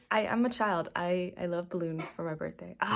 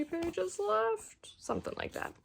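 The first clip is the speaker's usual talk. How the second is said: excited; 11 semitones higher, 4 dB quieter, slower than usual. Real, not acted. Excited hushed book update.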